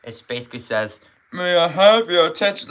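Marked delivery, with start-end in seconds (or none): 1.3-2.7 loud imitation of an old announcer; grandpa voice. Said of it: "this voice sounds like a grandpa's voice".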